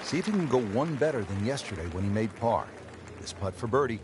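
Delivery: calm play-by-play commentary; measured golf broadcast voice; golf commentator. Acted.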